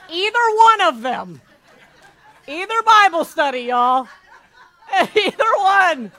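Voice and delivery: loud, high, laughing through the words; can't stop laughing at your own joke; laughing loudly. Real, not acted. Teasing the competitive group, laughing loudly and high. Joking about competitive Bible study.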